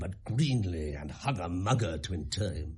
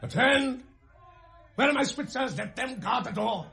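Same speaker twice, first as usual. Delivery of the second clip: shouted, much higher alarm call; king shouts for his guards, high and loud; 5 dB louder than usual. Acted.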